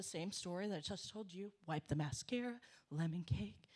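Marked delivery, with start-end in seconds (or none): none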